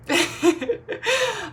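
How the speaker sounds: a real laugh breaking out. Real, not acted. Laughing as she recalls spotting the scratches, real laugh.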